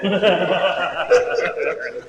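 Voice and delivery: real drawn-out laugh; amused laughter. Real, not acted. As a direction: laugh heartily at the mix-up. Laughs after confusion over reading.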